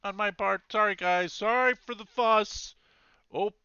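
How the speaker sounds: loud, high shouted apology; yelling out. Acted. Sentry apologising to his fellow guards, yelling out. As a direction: call apologies across to the other guards. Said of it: "as he's yelling out".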